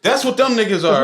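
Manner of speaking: ordinary talk, nothing marked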